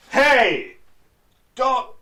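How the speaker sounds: high, loud, slow mock parental warning; parent scolding. Parents telling their teen son to watch his mouth, mock scolding. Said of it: "they'd be like".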